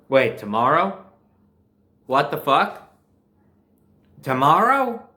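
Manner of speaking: stunned, can't believe school is back already; shocked disbelief. Retold or imitated. Hearing school resumes tomorrow, loud shocked disbelief. Reacting to sudden school reopening.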